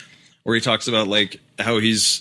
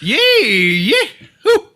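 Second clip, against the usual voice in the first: high, loud Appalachian holler; Appalachian accent; 13 semitones higher, 7 dB louder, slower than usual. Shown as a demonstration. Showing friends the Tennessee sound, whooping high Appalachian accent. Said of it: "There's Appalachian".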